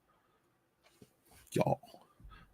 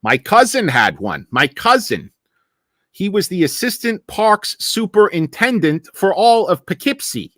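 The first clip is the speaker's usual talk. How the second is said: higher, hammed-up Northeastern accent; 7 semitones higher than usual. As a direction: brag in an exaggerated Northeast US accent. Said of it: "somebody in the North like".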